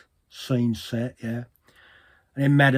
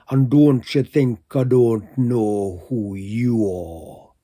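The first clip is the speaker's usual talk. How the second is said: slow, louder mock venom; venomous hiss; 6 dB louder, slower than usual. Fortune-teller rounds on Trot, slow mock venomous hiss. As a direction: imitate a fortune-teller spitting a threat slowly. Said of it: "hisses with real venom".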